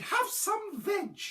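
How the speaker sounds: high, drawn-out duckbill offer; high honking dinosaur; hooted. A narrator's reading. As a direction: hoot it slowly up high. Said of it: "they hooted".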